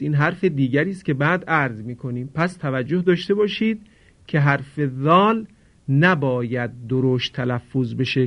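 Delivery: ordinary talk, nothing marked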